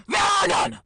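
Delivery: bursts into a high, loud shout; sudden scream. Acted. Reviewer suddenly screaming at the book's lack of good deeds. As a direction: explode out of calm into a shout. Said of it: "gets up and screams".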